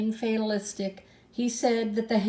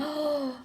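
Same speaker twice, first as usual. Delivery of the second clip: a sudden sharp intake of breath; sharp gasp; 6 semitones higher than usual. A narrator's reading. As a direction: gasp in shock.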